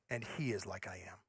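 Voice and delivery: very low and soft; quiet conclusion. Retold or imitated. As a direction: drop to a near hush. Voicing self-made god conclusion.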